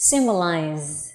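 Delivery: "Symbolize" slow and lingering on a buzzing z; final z. Shown as a demonstration.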